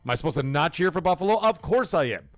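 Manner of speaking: usual speaking voice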